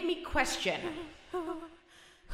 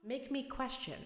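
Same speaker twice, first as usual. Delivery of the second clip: fading quiet; 5 semitones lower, 11 dB quieter than usual. Acted. Refrain fading out at the end.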